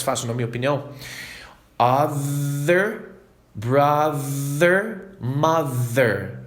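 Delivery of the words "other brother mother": Other, brother, mother drawn out with a voiced th; slow, careful drill of the voiced th; slow pronunciation drill. Shown as a demonstration. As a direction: drill for learners. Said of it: "exercício de pronúncia".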